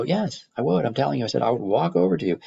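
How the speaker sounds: mock-heated classroom insistence; insistent retort. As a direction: press the point hard on the student. Teacher presses his point to student.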